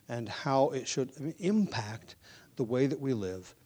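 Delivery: soft, hushed pulpit prayer. Acted. Pastor praying over the congregation before the sermon, soft and reverent. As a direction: pray it quietly and humbly.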